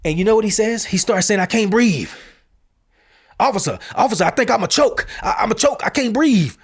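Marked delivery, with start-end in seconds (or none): 1.4-2.1 imitated agitated plea, raised pitch; lightly mimicked high plea; imitating agitated suspect. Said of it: "literally the way he was saying it". 3.4-6.6 mimicked high-pitched pleading to an officer; higher, agitated mock plea; imitating agitated suspect. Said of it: "literally the way he was saying it".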